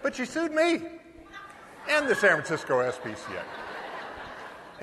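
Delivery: slow, amused payoff; slow laughing punchline. Real, not acted. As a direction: draw out the San Francisco S P C A slowly with a chuckle. Punchline, she sued both.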